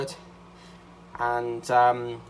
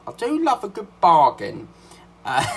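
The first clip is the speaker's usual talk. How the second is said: excited; 6 semitones higher, 9 dB louder than usual. Real, not acted. Gleeful aside about bargain buying.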